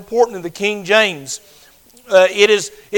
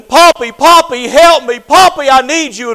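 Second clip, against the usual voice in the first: higher, louder imitated child crying out; child screaming for help; 6 semitones higher, 7 dB louder than usual. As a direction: call out like a frightened grandchild. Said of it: "she'd be there screaming".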